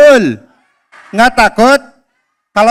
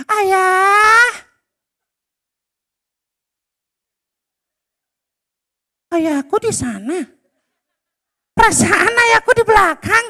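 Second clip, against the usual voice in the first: put-on high boy voice calling out, confused; child calling father; 10 semitones higher than usual. Boy Abdi calling his father, high and puzzled. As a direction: shout for dad like a lost little boy.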